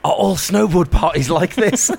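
high, loud and drawn-out mock question; loud relay. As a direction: announce the whispered question out loud. Repeats whispered line aloud for listeners.

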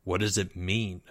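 low, soft musing; to himself. A narrator's reading. Mister L watching the field, quietly to himself. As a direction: murmur it privately. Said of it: "said Mister L to himself".

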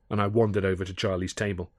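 plain reading voice

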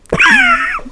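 high squealing laugh; real burst of laughter. Real, not acted. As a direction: crack up high-pitched. Cracks up at the line.

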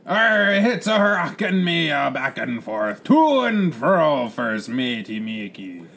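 high, loud, stretched pirate cry; bellowing pirate captain. Acted. Captain demands news of first mate, bellowing pirate. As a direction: bellow it across the cabin, drawn out.

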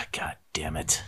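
sigh, then whisper it under your breath; defeated whisper; a trapped player muttering a whispered curse. Real, not acted.

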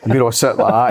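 normal talking voice

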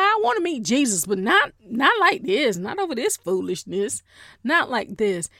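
insistent acted objection; exclaimed protest. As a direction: protest it emphatically, with punched words. Acted plea not to die this way.

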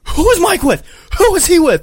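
acted high-pitched, loud demand; angry yelling. Wife confronting her husband's friend, angry yelling. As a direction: yell it like a furious wife demanding answers. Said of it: "Jenna gets angry and starts yelling".

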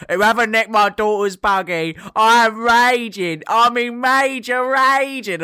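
mock the woman's screaming rant, high-pitched and loud; hammed-up outraged woman from the viral video, the last phrases slow; raging mum. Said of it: "that woman who's like".

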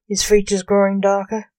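ordinary delivery

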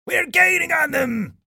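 high, excited pirate shout. A narrator's reading. Old Jim sees his ship catching up, shouting. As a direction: call the good news out to the crew. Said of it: "shouted Old Jim".

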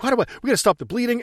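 high, rushed mock panic; imitated freak-out. Reporter trying to stop the bleeding, imitated panic. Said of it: "as she's freaking out".

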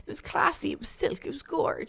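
mock glamorous. Showing off a cheap skirt.

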